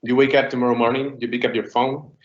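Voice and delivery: usual speaking voice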